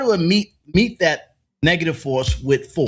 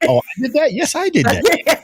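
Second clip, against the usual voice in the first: mock smug admission; 8 semitones higher than usual. Host voicing the president admitting blame, high smug gloat. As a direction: gloat it out like a cartoon villain.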